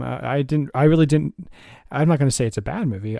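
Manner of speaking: usual speaking voice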